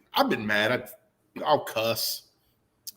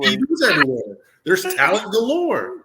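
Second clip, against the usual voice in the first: mock hype; 7 semitones higher, 5 dB louder than usual. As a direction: talk it up like a showman. Mock hype about Hooters.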